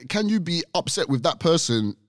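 ordinary talk

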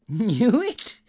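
high, loud rising exclamation; excited old Scrooge, astonished to be asked; incredulous delight. A narrator's reading. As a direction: echo the question in delighted disbelief. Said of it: "asked Scrooge if he knew it".

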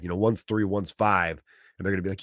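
usual speaking voice

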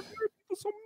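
small, high-pitched wounded voice; mock hurt complaint. Host quotes a man upset by mean emails, mock hurt.

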